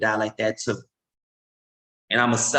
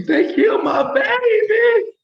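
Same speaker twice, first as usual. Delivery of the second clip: much higher, louder, slow anguished shout; wailing mother; 5 dB louder, slower than usual. Pretend. Mother on the news grieving her shot son, wailing. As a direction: cry it out as a shattered mother.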